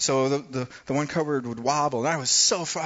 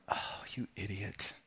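soft, slowed self-reproach under the breath; husband mutters at himself after snapping at his wife, hushed; muttering to himself; 4 semitones lower, 12 dB quieter, slower than usual. Retold or imitated. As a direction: scold yourself quietly, almost to yourself. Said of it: "all of a sudden I'm, like, going".

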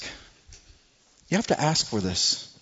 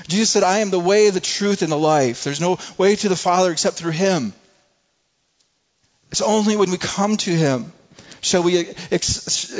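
raised, loud, urgent sermon voice; preaching at full force; 6 semitones higher, 4 dB louder than usual. Acted. Pastor urging the congregation to come, loud impassioned preaching. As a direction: preach it out, pleading.

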